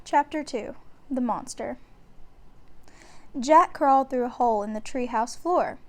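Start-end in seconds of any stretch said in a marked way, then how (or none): none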